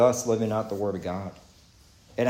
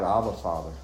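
"Abba, Father" lower and softer, falling gently; heartfelt cry; 5 semitones lower, 4 dB quieter than usual. Shown as a demonstration. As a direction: say "Abba, Father" low and quiet, as a sincere personal prayer. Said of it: "I cry out".

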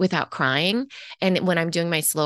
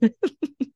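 short laugh; 8 semitones higher than usual. Real, not acted. Laughs at her own word.

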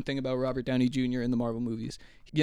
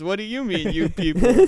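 higher put-on voice, louder on people; Booty Sweat quote; 6 semitones higher, 9 dB louder than usual. Mimicking Booty Sweat echoing the same question, higher and louder. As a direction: echo the line in a different character's voice, punching people. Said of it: "And then Booty Sweat goes".

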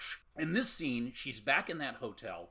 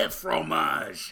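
mock French; 5 semitones higher, 10 dB louder than usual. Joking about French film.

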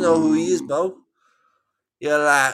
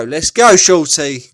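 hype call; 9 dB louder than usual. Real, not acted. Hyping up a game partner.

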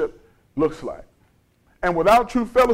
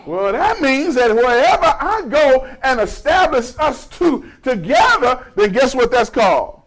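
raised, emphatic pulpit voice; forceful preaching; 6 semitones higher than usual. Real, not acted. Preacher asks the church what gathering is called, forceful. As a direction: build the point high toward a question.

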